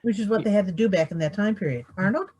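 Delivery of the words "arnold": normal talking voice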